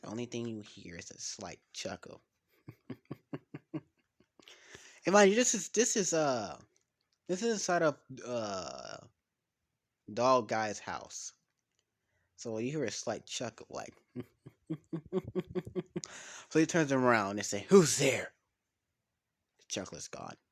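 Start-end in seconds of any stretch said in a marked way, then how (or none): 2.7-3.8 soft unvoiced sinister chuckle; a faint hidden laugh from the shadows; breathy villain snicker; creepy chuckle. 17.7-18.3 louder startled call; sudden loud who's there. Acted. Startled homeowner calls out.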